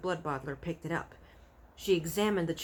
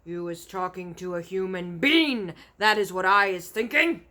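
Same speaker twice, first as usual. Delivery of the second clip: angry giant; 8 dB louder than usual. A narrator's reading. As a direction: roar the accusation. Giant accuses BFG.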